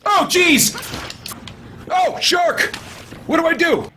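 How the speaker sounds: high frightened cries; panicked shout. Real, not acted. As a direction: panic as the shark attacks. Shark attacks in game.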